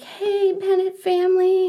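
very high-pitched imitation of an oblivious doctor's greeting; sing-song mock bedside cheer; mock cheery doctor. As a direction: greet the family in a put-on chirp. Doctor greeting family, unaware.

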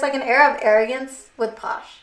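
normal talking voice

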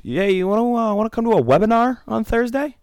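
hammed-up high pitch invite; mocking eager kid. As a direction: squeak it up like a keen church kid. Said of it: "One of the kids goes".

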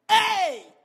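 high, shouted exhortation; full-force preaching. Real, not acted. Preacher urges listeners to do their part, shouting. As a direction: shout it to the whole congregation with urgency.

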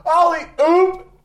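high, loud cheer; excited whoop. Acted. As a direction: whoop it out like a fan. Cheering for the otter.

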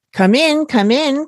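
high bright call to the door; welcoming call from the host. A narrator's reading. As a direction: call the guests in cheerfully. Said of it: "called Dog".

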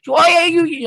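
loud, high Pittsburgh twang; Pittsburgh accent. Retold or imitated. Host mimicking a Pittsburgh woman, loud and high Pittsburgh accent. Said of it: "she sounds like".

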